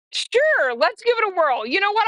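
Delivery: acted-out sudden enthusiasm; retold eager agreement. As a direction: jump the pitch up and go along with it.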